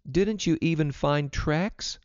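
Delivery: put-on high, slow, questioning girl; girl's question. A narrator's reading. Vida questions father home from the hunt, high and slow. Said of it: "asked Vida".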